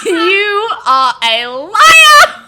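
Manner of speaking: high laughing yell, slowed down; laughing shout. Pretend. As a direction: shout the accusation while cracking up. Mock accusing listeners, laughing.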